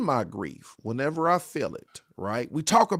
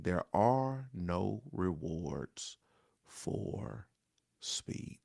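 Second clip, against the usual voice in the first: whispered, slow and soft; near-whispered closing thought; hushed whisper; 6 semitones lower, 9 dB quieter, much slower than usual. Real, not acted. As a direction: breathe the final words.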